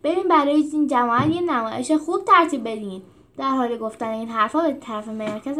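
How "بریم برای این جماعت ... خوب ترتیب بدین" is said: loud, higher rallying call; loud rallying shout to teammate; fired up. A narrator's reading. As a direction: rouse the team. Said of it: "روس عصبانی شد".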